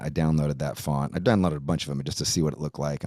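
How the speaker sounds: ordinary talk, nothing marked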